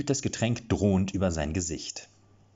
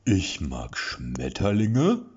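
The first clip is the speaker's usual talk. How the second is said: put-on big baby voice; much slower than usual. A narrator's reading. Lasse introducing himself to Erik in a big baby voice. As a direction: talk like an overgrown toddler. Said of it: "eher zu einem großen Baby passte".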